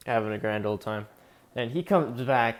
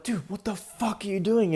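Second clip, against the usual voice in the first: alarmed disbelief; 8 semitones higher than usual. Retold or imitated. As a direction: imitate a freaked-out bystander, pitched way up. Retold protest after second shot.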